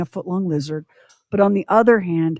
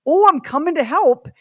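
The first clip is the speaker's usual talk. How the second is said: breezy cheerful helper; 9 semitones higher, 5 dB louder than usual. Retold or imitated. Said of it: "that just says".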